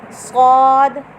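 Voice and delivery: "स्वाद" heavy with a whistle, loud; heavy whistled swad. Shown as a demonstration. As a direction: make "स्वाद" thick and hissed. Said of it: "स्वाद मोटा है।". ("स्वाद" मोटा, सीटी के साथ)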